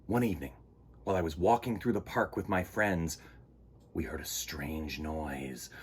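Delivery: put-on gentle read-aloud to children; story narration. A narrator's reading.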